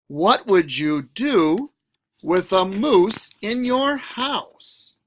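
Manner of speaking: lively, sing-song picture book read; reading to children. A narrator's reading. Teacher reading a picture book to students, animated. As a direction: read it to a class with big expression.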